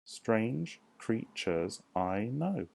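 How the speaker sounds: drawn out, syllable by syllable; slow syllable count. Shown as a demonstration. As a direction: count it out slowly. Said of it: "teacher counts syllables aloud".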